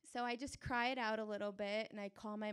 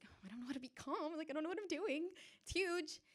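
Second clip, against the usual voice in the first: starts in a rushed whisper, then climbs higher; fast, panicky worry about the huge horse; mock panic up high; panicked; 7 semitones higher, faster than usual.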